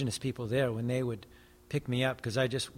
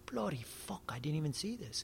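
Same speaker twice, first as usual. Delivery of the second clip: much softer, dumbfounded realisation; stunned quiet quote; 10 dB quieter than usual. Retold or imitated. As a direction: let it drop to a hushed, stunned admission. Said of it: "And he's like".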